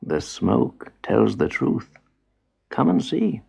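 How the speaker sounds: calm grandfather. Acted. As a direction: answer him gently, slowly.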